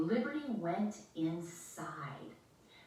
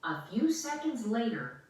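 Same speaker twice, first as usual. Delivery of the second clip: plain reading voice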